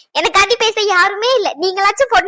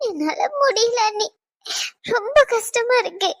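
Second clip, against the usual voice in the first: breaking down; 3 semitones higher than usual. Acted. Wife breaks down to sister-in-law.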